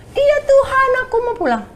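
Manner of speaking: put-on high, pleading little child voice; frightened child answering God; like a small child. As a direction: beg like a scared small kid. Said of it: "Kayak anak kecil".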